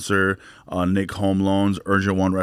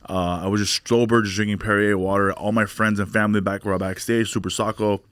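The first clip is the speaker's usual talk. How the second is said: ordinary talk, nothing marked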